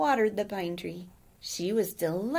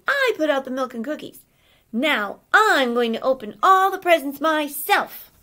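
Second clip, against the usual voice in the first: louder, rising fed-up rant; indignant scolding; 5 semitones higher, 8 dB louder than usual. Acted. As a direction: list every job she did, building anger. Elf scolds lazy friends.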